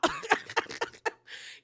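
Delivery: real high cackle; breathless, high-pitched cracking up; cackling laugh. Real, not acted. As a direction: burst out in helpless high laughter. Cracking up at the retold meltdown.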